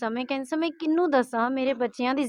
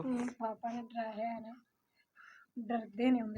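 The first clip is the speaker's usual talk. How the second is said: tearful; 15 dB quieter than usual. Real, not acted. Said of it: "اس میں رونے کی کیا بات ہے بیٹا".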